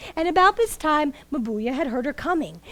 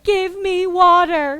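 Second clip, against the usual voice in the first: high, slow plea for water, louder; begging; 6 semitones higher, 8 dB louder, slower than usual. Acted. Thirsty cow begging the keeper for water, slow and high. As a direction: plead as if parched. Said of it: "she started to beg saying".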